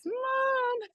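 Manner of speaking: put-on high child calling Mom. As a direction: call out like a small kid. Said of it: "I hear this".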